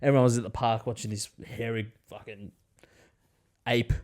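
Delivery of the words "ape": usual speaking voice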